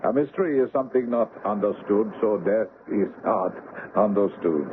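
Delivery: ordinary talk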